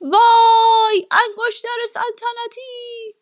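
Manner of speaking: high, long-drawn wail of alarm; drawn-out shout of dismay; worried cry. A narrator's reading. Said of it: "اون نگران شد و فریاد کشید".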